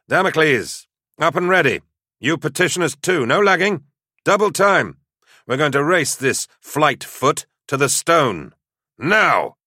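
high, loud drill-sergeant commands; rally the squad at full urgency; shouted orders. A narrator's reading.

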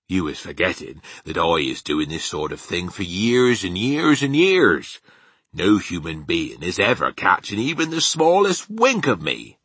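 BFG boasting, swelling on the years; boastful giant. Acted. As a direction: build up proudly as a folksy giant. Narrator voices the boasting BFG.

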